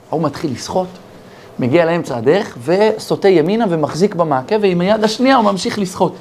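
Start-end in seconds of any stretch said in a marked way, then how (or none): none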